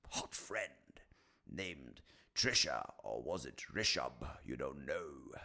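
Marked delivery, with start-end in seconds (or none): none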